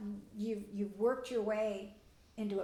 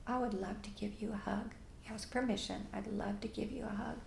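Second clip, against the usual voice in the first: tender whisper; 9 dB quieter than usual. Shown as a demonstration. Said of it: "moment that child starts to cry".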